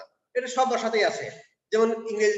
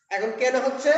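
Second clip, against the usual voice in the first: ordinary delivery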